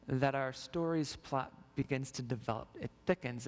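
normal talking voice

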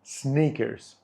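slow, careful "sneakers" said like the chocolate bar; slowly saying "sneakers" as snickers; snickers, not sneakers. Shown as a demonstration. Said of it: "Якщо ви хочете сказати про шоколад, кажіть".

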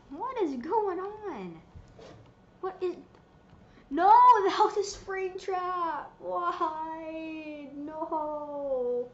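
confused yelling at the screen; startled and loud; alarmed shouting. Real, not acted. Caught in trap.

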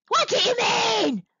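high, outraged monster shout; monster roar. A narrator's reading. Offended monster answering Monkey, roaring. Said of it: "roared the monster".

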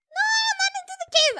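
high, loud, rushed protest; desperate cry. Real, not acted. Player watching the cat enter the cave, desperate. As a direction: wail it in alarm.

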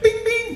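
high beep-beep imitation; scanner beeps. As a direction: chirp like a card reader. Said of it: "was like".

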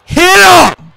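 very high, loud urgent shout. Real, not acted. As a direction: yell the command at the screen. Urging partner in game.

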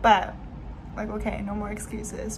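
ordinary delivery

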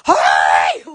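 sudden loud "hey" call; loud hail. Shown as a demonstration. Would-be president showing off an unamplified voice, loud holler. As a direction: holler with no microphone. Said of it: "I'll just use my voice".

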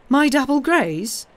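cried out. A narrator's reading. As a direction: burst out in disbelief at the sight. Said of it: "Madame Danglars cried".

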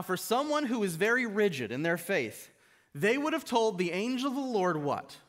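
ordinary delivery

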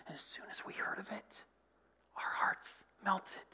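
hushed, breathy-free whisper of dread; fearful whispered confession. Acted. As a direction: whisper it like a frightened secret. Rahab's fear read as whisper.

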